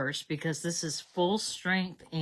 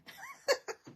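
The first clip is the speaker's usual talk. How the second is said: a squeaky high laugh; giggling at herself; high giggle; 3 dB quieter than usual. Real, not acted. Laughs at trashing paper again.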